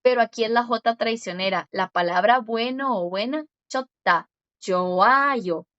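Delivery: normal talking voice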